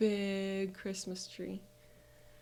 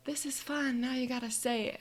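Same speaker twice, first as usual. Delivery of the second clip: higher, playful mom voice; mom's catchphrase; 5 semitones higher than usual. Retold or imitated. Mom saying her usual fun catchphrase, playful imitation. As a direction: lightly imitate a cheery mom, pitched up. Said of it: "my mom always goes".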